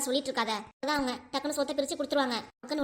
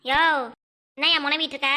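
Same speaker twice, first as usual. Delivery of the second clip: louder call across the house; 6 dB louder than usual. Acted. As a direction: shout to catch his grumbling. Wife calling out to grumbling husband. (முணுமுணுப்பவரை உரக்க அழைக்கும் மனைவி)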